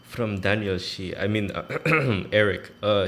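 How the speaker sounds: ordinary delivery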